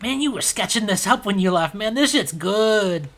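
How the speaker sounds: awed admirer. Acted. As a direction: marvel at the machine. Admiring the Tuit.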